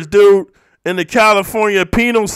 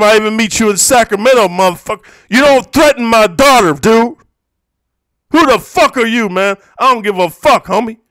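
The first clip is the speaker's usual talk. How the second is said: loud, higher-pitched retold confrontation; retold angry challenge; 5 semitones higher, 6 dB louder than usual. Father retelling his challenge to whoever threatened his daughter, angry. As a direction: re-enact facing down an enemy, loud and heated.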